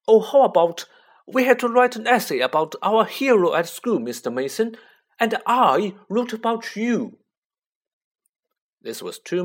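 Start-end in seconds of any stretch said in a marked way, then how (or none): 0.1-7.2 put-on high loud smarmy boy voice; eager show-off boy; Dudley voice. A narrator's reading. Dudley rehearses flattery.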